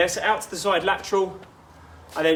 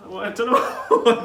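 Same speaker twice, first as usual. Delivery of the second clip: laughing; 4 semitones higher, 5 dB louder than usual. Real, not acted. Laughing at her sore eyes.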